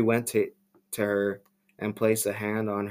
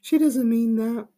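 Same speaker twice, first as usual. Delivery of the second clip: much higher, smooth, buttery soft voice; soft creepy Tommy; 13 semitones higher, 5 dB louder than usual. Acted. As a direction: soothe them like soft butter, unsettlingly sweet. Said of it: "his voice like soft butter".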